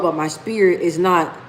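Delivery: ordinary delivery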